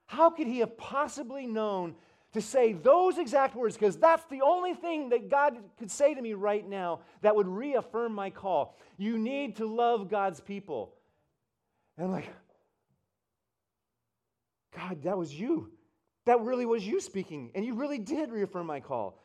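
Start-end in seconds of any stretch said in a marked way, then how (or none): 0.1-11.0 loud, high, drawn-out disbelief; astonished outburst. Retold or imitated. Acting out his stunned reaction. 14.7-19.2 high, loud exclamation to God; amazed realization. Retold or imitated. Acting out his realization to God.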